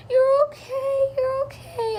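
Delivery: high, slow, crying whisper of comfort; tearful soothing. Retold or imitated. New mother soothing her crying newborn, high tearful hush. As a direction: soothe the newborn through tears.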